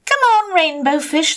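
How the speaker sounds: high, loud, playful call; calling fish friends. Acted. Other fish calling Rainbow Fish.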